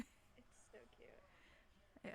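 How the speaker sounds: breathy whisper. Real, not acted. Cooing over a cute child.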